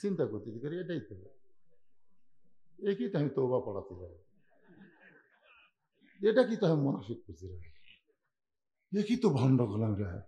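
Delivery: low, hushed inner monologue; thinking to himself. Acted. Rakhal silently worrying over whom he insulted, hushed and low. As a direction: think it quietly in quick, low fragments. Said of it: "মনে মনে এই কথা চিন্তা করতেছে".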